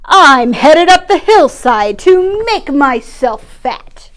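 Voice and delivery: slow, loud, big goat voice; slow, loud, proud goat; biggest goat. Acted. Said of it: "the biggest billy goat announced".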